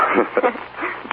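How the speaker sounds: high chuckle. Acted. Husband laughs at wife's joke.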